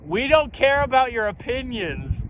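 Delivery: loud, high, slow proclamation; team proclaiming it. Pretend. Founder imagining his team declaring it loudly. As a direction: boom it out like a rally chant. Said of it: "I'm just like".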